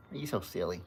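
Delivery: ordinary delivery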